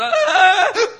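long, loud, high-pitched bawl; hammed-up child's cry; mock crying wail. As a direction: wail "ah" at full volume. Acting out his crying.

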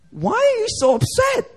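very high-pitched, incredulous question; Jesus. Retold or imitated. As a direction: ask it in a soaring, exasperated voice, imitating. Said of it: "And Jesus is like".

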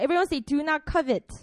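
usual speaking voice

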